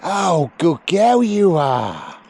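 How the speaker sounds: slow, high, drawn-out praise; lavish praise on the dog slowly. Real, not acted. Trainer praising the sheepdog after a good run. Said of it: "bit of encouragement".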